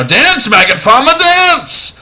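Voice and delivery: high, loud movie-dub yell; over-the-top imitation of an action-scene yell; mock frantic shout. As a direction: shout it hammed-up, rising to the last word. Mimicking the hilarious dubbed scene.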